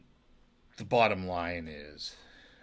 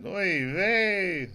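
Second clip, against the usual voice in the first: Oy vey thrown out higher and louder, with a put-on Yiddish lilt; hammed-up Yiddish exclamation; 11 semitones higher, 10 dB louder than usual. Shown as a demonstration. Joking about Blazing Saddles, a loud mock Yiddish oy vey. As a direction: lean into Oy vey like a borscht-belt comic. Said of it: "I couldn't even say that".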